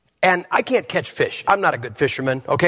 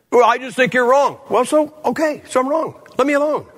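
higher, exasperated retort; 7 semitones higher than usual. Retold or imitated. Preacher answering the scolding lady, exasperated and higher. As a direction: throw up your hands in the reply.

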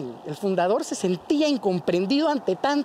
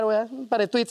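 usual speaking voice

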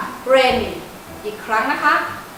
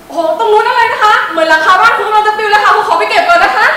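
alarmed laughing exclaim; 8 semitones higher, 8 dB louder than usual. Real, not acted. Roof blowing off in wind.

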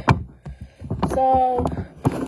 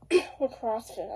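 coughing through the words, slow and hoarse; coughing fit; 2 semitones lower, 5 dB quieter, slower than usual. Real, not acted. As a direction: speak between coughs. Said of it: "I'm sick today".

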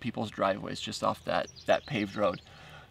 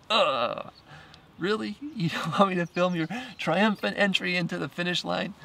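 disappointed disbelief; 8 semitones higher than usual. Retold or imitated. She refuses to be filmed.

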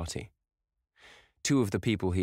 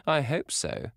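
laughing; 9 semitones higher, slower than usual. A narrator's reading.

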